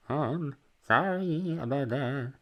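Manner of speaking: high, slow, strained apology; almost-crying voice. Retold or imitated. Server apologising to customer, about-to-cry voice. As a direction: mimic holding back tears as you apologise. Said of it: "almost about to cry voice".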